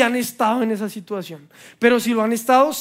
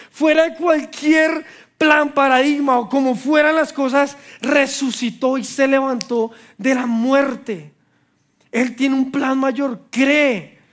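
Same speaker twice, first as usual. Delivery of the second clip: preaching at full force; 4 semitones higher than usual. Acted. Preacher urges the congregation to believe, fervent. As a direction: drive the message home, fervent.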